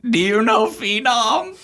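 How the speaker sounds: hammed-up high, loud crying voice, words dragged out; tearful wail. Weeping Clara asks about her crush, high tearful wail. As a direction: wail it slowly through mock tears. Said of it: "a really hideous crying face".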